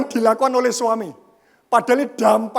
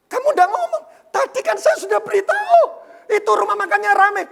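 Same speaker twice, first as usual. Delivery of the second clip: much higher, louder, near-shouting retort; wife protesting in a high, raised mock voice; retold indignant protest; 9 semitones higher, 4 dB louder than usual. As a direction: insist you already told him. Wife insists she told him.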